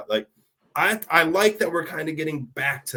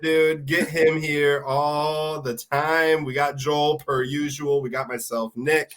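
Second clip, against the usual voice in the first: ordinary talk, nothing marked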